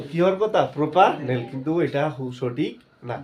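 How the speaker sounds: ordinary delivery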